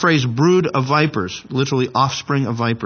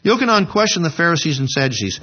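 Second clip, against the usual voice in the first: ordinary talk, nothing marked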